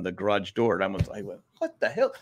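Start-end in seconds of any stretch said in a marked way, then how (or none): 1.6-2.1 high-pitched retold startle; startled reaction. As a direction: act out a high, startled double take. Retelling startle at snake overhead.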